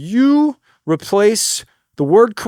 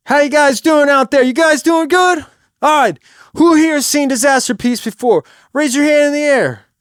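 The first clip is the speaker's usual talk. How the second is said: loud, high stage address; projected voice; 8 semitones higher, 5 dB louder than usual. Shown as a demonstration. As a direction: project to the back of the room. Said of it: "You gotta project your voice".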